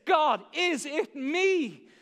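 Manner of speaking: slow, earnest plea to God; sincere plea. Shown as a demonstration. Preacher modelling the right prayer, slow and sincere. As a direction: ask it slowly, like a real prayer. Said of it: "the right question is singularly this".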